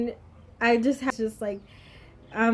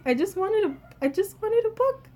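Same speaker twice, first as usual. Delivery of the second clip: plaintive protest; 9 semitones higher than usual. Retold or imitated. Student who only asked for a book, plaintive. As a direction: plead your innocence, pitch climbing. Retold pleading inner protest.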